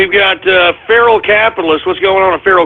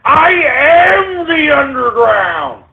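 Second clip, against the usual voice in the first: booming proclamation; 6 semitones higher, much slower than usual. Real, not acted. Ranting host shouts identity.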